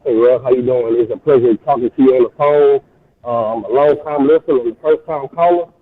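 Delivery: caller on speakerphone; tinny speakerphone sound; phone speaker. Real, not acted. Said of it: "get off the speakerphone".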